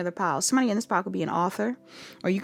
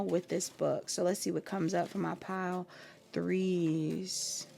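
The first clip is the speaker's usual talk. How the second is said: quiet; 8 dB quieter than usual. Real, not acted. Quietly asking the deck.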